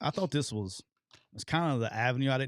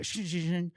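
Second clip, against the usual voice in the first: high repeated shing sound effect; imitated shanking noise; 6 semitones higher, slower than usual. Retelling a bad punt to the host, imitated high noise. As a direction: mimic the ball skidding off, pitched up.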